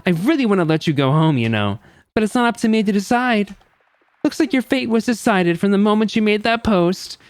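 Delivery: condescend theatrically to the condemned; mock-consoling show host. Host tells Boy A his fate is decided, mock-consoling.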